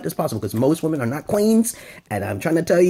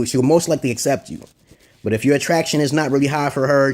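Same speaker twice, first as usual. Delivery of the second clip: normal talking voice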